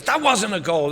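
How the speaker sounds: high, indignant protest to the ref; mock-outraged objection; arguing. Acted argument with the ref.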